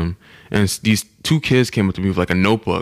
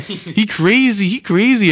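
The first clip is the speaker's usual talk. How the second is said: very high, stretched-out mock outcry; high, drawn-out retold alarm; imitating scared woman; 11 semitones higher, slower than usual. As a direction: mimic a spooked woman crying out. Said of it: "She gets scared".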